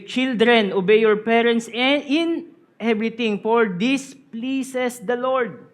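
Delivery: loud, slow emphatic Bible reading; preacher reading the verse aloud to his congregation; scripture reading. Acted. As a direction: proclaim the verse to the church.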